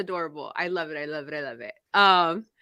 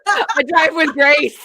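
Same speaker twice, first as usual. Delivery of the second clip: high, loud, giggly delivery; real laughter breaking up the words; laughing; 8 semitones higher, 6 dB louder than usual. Real, not acted. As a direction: crack up at your own joke while talking. Cracking up at own joke.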